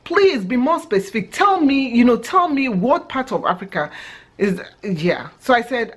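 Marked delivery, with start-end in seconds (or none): none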